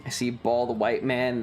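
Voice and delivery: ordinary talk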